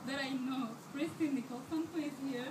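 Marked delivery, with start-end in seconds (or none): none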